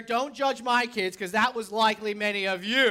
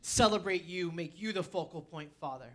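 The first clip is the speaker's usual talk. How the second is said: earnest, continuous prayer in a lowered voice; praying; 5 semitones lower, 3 dB quieter than usual. Real, not acted. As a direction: pray to God, pitch settling lower toward the amen. Opens with a prayer.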